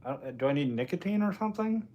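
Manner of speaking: ordinary talk, nothing marked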